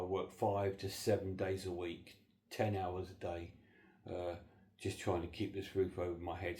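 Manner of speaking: normal talking voice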